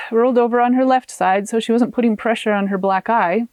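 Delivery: plain reading voice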